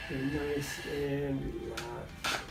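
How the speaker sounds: slow, soft reassuring murmur; soothing massage murmur. Real, not acted. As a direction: ease them into relaxing. Chiropractor soothing patient on table.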